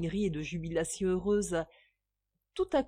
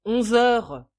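sharp, louder snapped answer; sour; 8 dB louder than usual. A narrator's reading. Wife snapping the hour back at her husband, sour. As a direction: bite the words off sourly. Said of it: "répondit-elle aigrement".

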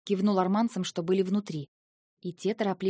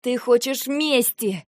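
accusing cry; 4 semitones higher, 5 dB louder, slower than usual. Acted. Eva accusing Lentar.

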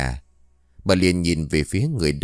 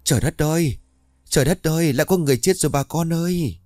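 panicked shout; 9 semitones higher than usual. A narrator's reading. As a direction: scream the news in horror. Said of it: "tiếng hét thất thanh của bà Hợi". (tiếng hét thất thanh)

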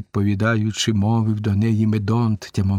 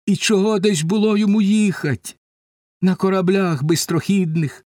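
higher-pitched, pained questioning; understated grief, voice lifted in pleading; distressed Penelope; 6 semitones higher than usual. A narrator's reading. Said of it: "голос, немов перервався, дзвонистий".

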